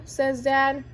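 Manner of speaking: ordinary delivery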